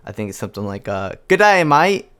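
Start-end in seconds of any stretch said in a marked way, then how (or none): 1.3-2.1 bad Australian accent, a bit higher and louder; deliberately awful Australian accent; Australian impression. Shown as a demonstration. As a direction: ham up a cheesy Aussie greeting. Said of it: "I don't really know how to say this in Australian".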